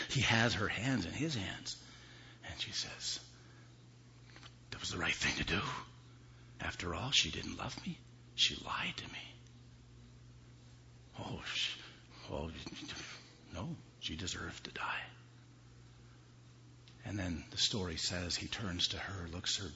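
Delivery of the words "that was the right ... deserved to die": creepy whisper. Acted. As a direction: whisper it as if reasonable. Husband's creepy confession.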